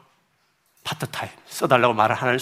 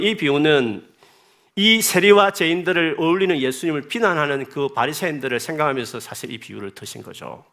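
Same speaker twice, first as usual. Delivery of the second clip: usual speaking voice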